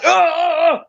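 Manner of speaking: high shouted grunts while hitting a bag; loud grunting yells. Retold or imitated. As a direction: yell out like you're straining on every punch. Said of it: "I'm gonna scream loud".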